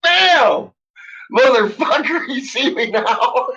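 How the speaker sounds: high, loud burst of bravado; hammed-up confrontational holler; triumphant mock shout. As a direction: belt it out as the brazen girlfriend. Re-enacting the girlfriend flashing the guard.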